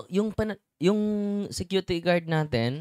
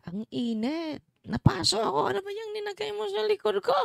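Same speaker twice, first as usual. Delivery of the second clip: much higher, anguished cry of a man burning; pained cry of heat. Retold or imitated. Possessed husband crying out to his wife in pain. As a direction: yelp as if scalded by the oil. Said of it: "Ang sabi niya sa kanyang asawa".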